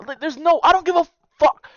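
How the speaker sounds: high, loud furious shout. Real, not acted. Ranting about breaking sibling loyalty, high loud shout. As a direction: let it explode.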